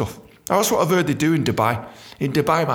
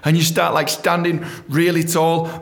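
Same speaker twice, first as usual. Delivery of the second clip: ordinary talk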